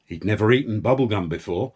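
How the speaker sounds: usual speaking voice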